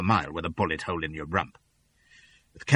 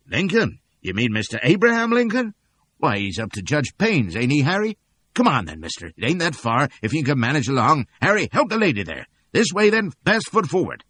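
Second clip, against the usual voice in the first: helpful townsman; 6 semitones higher, 4 dB louder than usual. A narrator's reading. Townsman guiding them.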